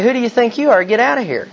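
playful mock confrontation; mock indignant retort. Imagined retort to a rude stranger.

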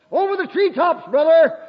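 high, jubilant call; exultant exclamation. Real, not acted. Preacher calling to a brother in the congregation, exultant. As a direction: sing out your joy high and strong.

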